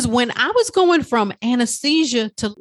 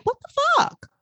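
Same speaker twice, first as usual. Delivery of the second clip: very high shocked squeak. Retold or imitated. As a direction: yelp it as the startled brain. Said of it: "Lower brain was like".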